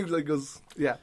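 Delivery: ordinary talk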